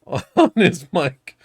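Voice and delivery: ordinary delivery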